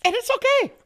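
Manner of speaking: loud, very high fake-tearful squeak; mock brave face. Comedian on his cancelled movie, mock-upset high squeal. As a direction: squeal it like you're pretending to cope.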